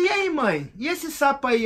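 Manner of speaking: high, pressing retold question; eager pressing question. Son presses his mother about the toad, high and eager. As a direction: push her to see the point.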